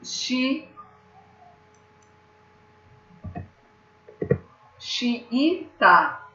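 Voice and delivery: xiita with a pause after xi, xi-i-ta; xiita split apart. Shown as a demonstration. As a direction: break xiita into its syllables. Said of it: "A gente fala assim, ó".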